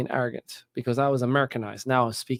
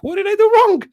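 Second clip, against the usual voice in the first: mock shocked squeal, much higher and louder; student caught out, squealing innocent surprise; shocked innocence; 8 dB louder than usual. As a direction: squeak it out in disbelief. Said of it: "I was like".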